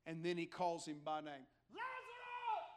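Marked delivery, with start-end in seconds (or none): 1.7-2.7 high, drawn-out distant cry; calling out. Acted. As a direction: call the name as if from far away. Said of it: "Did it not say he did in a loud voice?".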